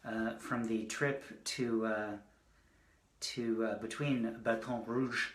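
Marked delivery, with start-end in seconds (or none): 4.4-5.3 lightly put-on French accent on Baton Rouge; French-style accent. Shown as a demonstration. Poet introducing trip poems, playful French accent. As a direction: give Baton Rouge a small French flourish. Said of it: "working on that accent all week".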